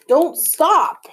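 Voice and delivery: high, loud yell on the run; urgent yell. A narrator's reading. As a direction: yell to keep someone running. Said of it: "by my arm would yell".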